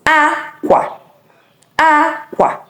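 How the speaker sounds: "aqua" pitched high, falling at the end; clothes tone. Shown as a demonstration. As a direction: start "aqua" high and let the end drop. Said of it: "The first one I said is aqua".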